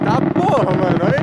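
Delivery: loud, high cheer at the fireworks; excited shout. Real, not acted. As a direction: yell it out thrilled. Cheering a big firework burst.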